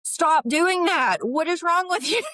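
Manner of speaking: loud, high exasperated snap; high mock scolding of a client; blunt scolding. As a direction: scold them, fed up and high. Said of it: "it had to be like".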